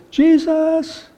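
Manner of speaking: a hint of whiny piety; pious whine. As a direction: play a self-righteous disciple. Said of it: "in a very pious voice, a little whine to it".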